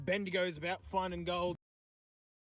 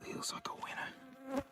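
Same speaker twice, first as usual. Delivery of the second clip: hushed whisper, breath only; 2 semitones lower, 3 dB quieter than usual. Real, not acted. Whispering to the camera about a winner nearby. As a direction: whisper so the man nearby can't hear.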